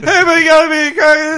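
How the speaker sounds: fast, high, laughing Homer voice; laughing Homer impression. Friend quoting Homer's frantic plea, fast and laughing. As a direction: rattle it off fast through laughter. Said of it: "quoting Homer, laughing fast".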